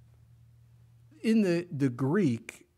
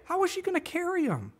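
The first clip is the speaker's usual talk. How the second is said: incredulous; 14 semitones higher than usual. Real, not acted. Incredulous rhetorical question.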